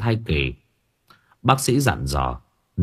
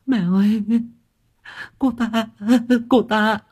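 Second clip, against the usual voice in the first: much higher, choked sobbing cry; bursting into tears; 13 semitones higher than usual. Acted. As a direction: cry out to her mother-in-law in tears. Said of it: "Thảo òa khóc".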